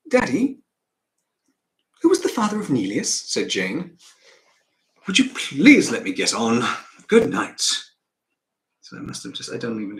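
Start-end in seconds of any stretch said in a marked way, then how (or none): 0.1-3.1 put-on higher, louder child calling on daddy; a child's eager, raised-pitch question. Acting the child's line. 5.0-7.6 loud, exasperated father; firm, loud brush-off from a busy father; exasperated dismissal. Acted. Acting father dismissing child.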